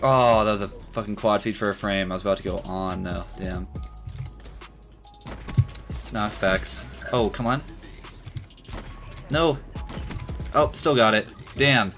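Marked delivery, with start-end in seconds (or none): none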